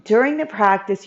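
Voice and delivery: usual speaking voice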